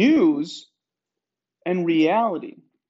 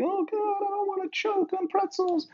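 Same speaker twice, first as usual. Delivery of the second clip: much higher, whiny mock plea; whiny mock panic; 12 semitones higher, 4 dB quieter than usual. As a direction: squeak it like a scared little mortal. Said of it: "And we're all like".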